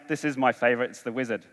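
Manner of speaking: normal talking voice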